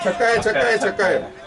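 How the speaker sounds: excited laughing cheer, high; six call. Real, not acted. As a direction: whoop it out with a laugh. Cheering a six while watching.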